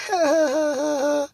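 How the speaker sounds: high, restrained ha ha; a careful held-back laugh; toned-down laugh. Shown as a demonstration. Showing how she holds back laughs, high restrained laugh. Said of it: "tone back my laughs".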